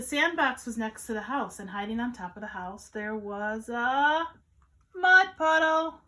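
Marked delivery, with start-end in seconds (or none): 2.9-6.0 very slow build to a loud call; big drawn-out storytime announcement; dramatic story reveal. A narrator's reading. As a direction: draw out the pause, then announce it. Dramatic reveal in picture book.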